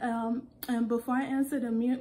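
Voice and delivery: ordinary talk, nothing marked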